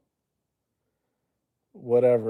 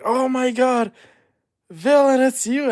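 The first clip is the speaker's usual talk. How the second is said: much higher and louder squeal of delight; excited; 13 semitones higher, 7 dB louder than usual. Pretend. Fan spotting him at the airport, squealing excitedly. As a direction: shriek it like you spotted your idol. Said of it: "you're gonna see me at the airport, like".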